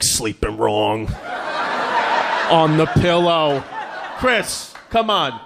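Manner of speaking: slow, drawn-out sceptical echo; mocking dad. Dad doubting his son's stiff neck excuse, slow mocking echo. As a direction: parrot it back slowly, dripping with doubt. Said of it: "It's like".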